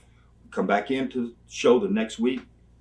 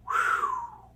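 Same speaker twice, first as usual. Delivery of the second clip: a high, stunned "whew" whistle; dismayed high whew; high whistled whew. Retold or imitated. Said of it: "and I just go".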